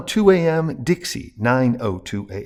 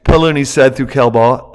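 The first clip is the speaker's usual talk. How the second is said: ordinary delivery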